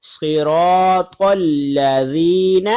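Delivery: slow chanted صراط الذین with a heavy ط; very slow melodic Quran recitation; Quranic recitation. Shown as a demonstration. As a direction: recite it drawn out, tajweed style. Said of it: "جیسے قرآن کریم میں ہے".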